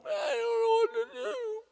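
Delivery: high, crying helplessness; Jesus alone at night, crying in despair; weary cry. Retold or imitated. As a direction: let it break into a cry. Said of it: "Jesus probably fell asleep a lot of nights going".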